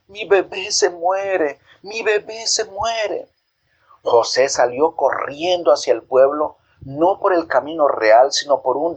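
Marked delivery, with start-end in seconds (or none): 0.1-3.3 put-on a mother fearing for her baby, drawn out; slow high lament; mild mock scream. A narrator's reading. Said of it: "Doña Ruth comenzó a gritar".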